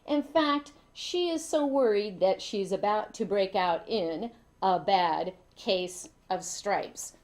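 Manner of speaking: ordinary delivery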